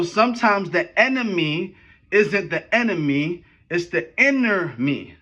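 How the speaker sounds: slow for emphasis. Shown as a demonstration. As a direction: spell out the wordplay slowly for the listeners. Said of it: "I'ma say that slow for y'all".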